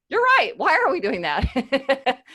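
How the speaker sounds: hammed-up sudden agreement, stretching the question out; mock eager agreement. Agreeing with the questioner's objection in hammed-up surprise.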